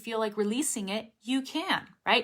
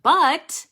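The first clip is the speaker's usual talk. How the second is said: BUT punched louder, final T released; emphatic released T; 8 semitones higher, 10 dB louder than usual. Shown as a demonstration. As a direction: hit "but" hard and let the T pop. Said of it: "I wanted to emphasize that word".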